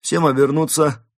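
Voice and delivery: loud, high police order; commanding. A narrator's reading. Police moose ordering suspects to turn, commanding. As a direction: give a firm order to all. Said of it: "повелительный голос".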